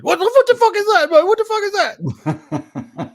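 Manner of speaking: high, loud mock outrage; loud mocked disbelief; mates reacting. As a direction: ham up the mates' shock.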